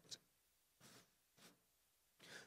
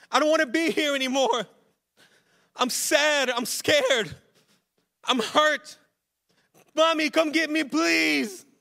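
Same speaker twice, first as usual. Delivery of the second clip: put-on high, loud child's plea; imitated scared screaming; 11 semitones higher, 10 dB louder than usual. Dad imitating his scared son calling his parents, pleading yell. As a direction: yell for help like a frightened little boy. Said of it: "he screams and yells".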